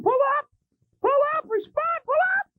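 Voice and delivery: high frantic shouts of pull up; panicked shouting. Retold or imitated. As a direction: yell it in rising panic. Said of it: "some guy sitting in a tower".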